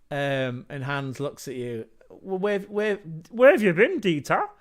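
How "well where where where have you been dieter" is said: hammed-up German accent, pitched high and loud; a jolly German fan greeting his mate in a bad German accent; terrible German accent. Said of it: "another terrible accent".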